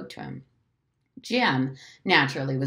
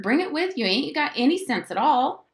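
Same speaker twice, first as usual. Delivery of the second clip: high, scolding call; high, impatient boy's scolding; exasperated hollering; 9 semitones higher, 3 dB louder than usual. A narrator's reading. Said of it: "Jem hollered".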